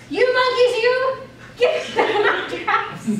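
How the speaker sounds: angry yell breaking into laughter; angry peddler. Acted. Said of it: "This made the peddler angry".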